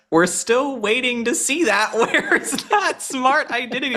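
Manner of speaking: high, laughing ridicule; mocking laugh. Real, not acted. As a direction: laugh at her while asking.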